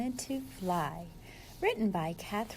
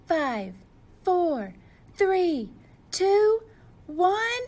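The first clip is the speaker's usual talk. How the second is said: countdown climbing higher and louder; countdown building up; 7 semitones higher, 7 dB louder, much slower than usual. Acted. Penguin counting down to takeoff, rising higher and louder. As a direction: build the excitement with each number.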